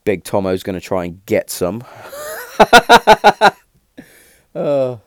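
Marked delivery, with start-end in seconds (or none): 1.9-3.5 big high burst of laughter; laughing hard, high and loud. Real, not acted. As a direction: crack up loudly at the joke. Laughing at dad's selfie.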